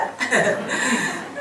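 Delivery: soft breathy chuckle; soft laugh. Real, not acted. Preacher chuckling at her own childhood story, soft laugh. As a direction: chuckle quietly at your own joke. Joke about stealing papa's money.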